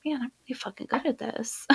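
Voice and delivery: fast, secret whisper; quick whispered brag to herself; whispered self-praise. Retold or imitated. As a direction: whisper it to yourself, quick and proud. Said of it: "and I'm like".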